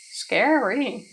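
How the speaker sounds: a touch of mock fear, mild; lightly scared. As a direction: say it lightly, half-joking. Milder reaction line.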